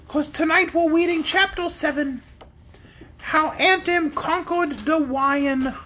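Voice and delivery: high, trembling lion whine; Cowardly Lion voice. Pretend. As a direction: quaver it out like a scared lion. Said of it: "I'm doing the Cowardly Lion voice already".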